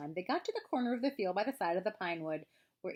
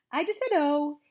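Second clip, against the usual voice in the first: high, loud, defensive squeak; jumpy raised voice; nervous; 9 semitones higher, 11 dB louder than usual. A narrator's reading. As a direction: blurt it out nervously. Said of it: "said Piglet nervously".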